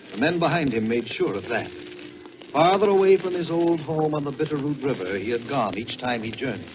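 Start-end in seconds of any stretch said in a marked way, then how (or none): none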